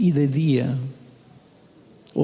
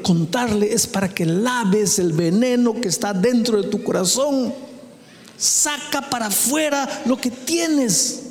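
high, insistent sermon voice; raised-pitch pulpit exhortation; impassioned preaching; 7 semitones higher than usual. Acted. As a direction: drive the point home from the pulpit.

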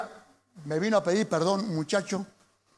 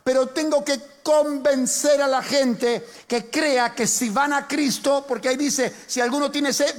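preaching; 5 semitones higher than usual. Acted. Pastor preaching to the congregation, rising and emphatic. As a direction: drive the point home to the congregation.

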